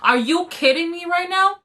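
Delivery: retold yell. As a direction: act out an outraged yell at half volume. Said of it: "I yelled as loud as I could".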